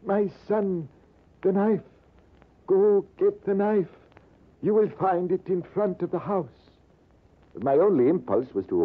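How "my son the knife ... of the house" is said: each word costs him effort; a buried old man gasping out instructions; old Persian straining to speak. Retold or imitated. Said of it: "The old Persian spoke with difficulty".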